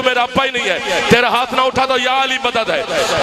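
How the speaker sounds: usual speaking voice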